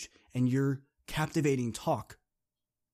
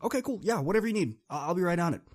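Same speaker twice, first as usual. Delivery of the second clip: higher, breezy don't-care voice; indifferent teammate; 8 semitones higher, 4 dB louder, faster than usual. Retold or imitated. Kayla shrugging off Dean's orders, high and breezy. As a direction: brush it off, whatever you need.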